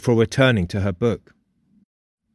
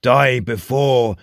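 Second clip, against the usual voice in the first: ghost; slower than usual. A narrator's reading. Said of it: "repeated the ghost".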